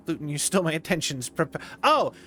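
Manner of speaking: ordinary talk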